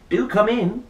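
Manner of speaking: light polite little host; politest voice. Acted. Rory greeting the monster babysitter, lightly polite. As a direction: greet the guest with best manners. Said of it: "in his politest voice".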